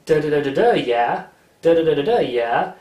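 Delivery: light Canadian accent, retold. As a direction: imitate a friend's Canadian da da da, yeah. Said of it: "And she did".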